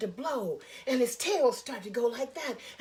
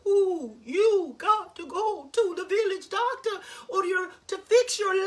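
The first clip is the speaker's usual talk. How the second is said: higher, louder owl hooting its warning; 7 semitones higher, 7 dB louder, slower than usual. Acted. Wise old owl scolding Sangura with a loud hoot. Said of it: "even the wise old owl said".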